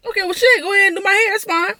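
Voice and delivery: high, breezy go-ahead; mock agreeable. Telling her ex to go ahead, a high breezy okay. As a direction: breeze through it sky-high.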